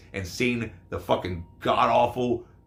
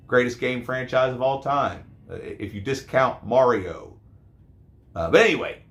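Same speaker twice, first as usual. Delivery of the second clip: ordinary delivery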